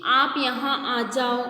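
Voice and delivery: drawn-out, slow call to someone far away; a lightly voiced call across a distance; calling from afar. Shown as a demonstration. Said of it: "हम बुला रहे हैं कि". (दूर खड़े व्यक्ति को धीमे, खिंचे स्वर में हल्की पुकार)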